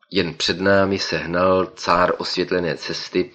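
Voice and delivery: ordinary delivery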